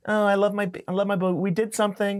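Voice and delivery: high, gushing mock of a couple in love; gushing couple mockery. Mocking a happy Instagram couple, high and gushing. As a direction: ham up a gooey partner bragging online.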